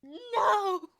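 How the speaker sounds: high, loud anguished "no"; dramatic scream. Retold or imitated. Fan reacting to the lip-sync fail, dramatic scream. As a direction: wail it out in hammed-up despair.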